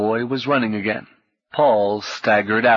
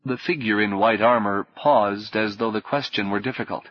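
ordinary delivery, nothing marked

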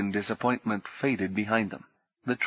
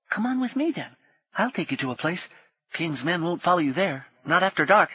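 put-on much higher boy's voice, hushed and quick; urgent potboy; 8 semitones higher than usual. Potboy warning Paul of soldiers, high and hushed. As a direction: warn him like a frightened street boy.

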